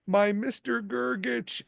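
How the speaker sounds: high, slow, adoring coo; delighted greeting. Retold or imitated. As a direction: imitate a gushing greeting to a beloved. Retold actress greeting her screen husband.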